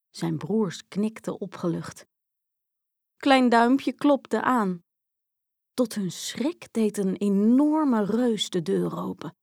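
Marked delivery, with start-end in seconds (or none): none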